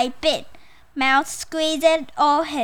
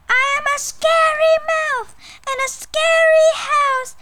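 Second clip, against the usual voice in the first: very high, loud squeaky shout; piercing storybook mouse battle cry; big brave mouse; 6 dB louder than usual. Acted. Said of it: "called in his big, brave mouth".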